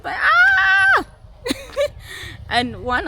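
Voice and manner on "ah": huge high shriek of pain; labor scream. Retold or imitated. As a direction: let out a full-throated birthing scream. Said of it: "I started screaming".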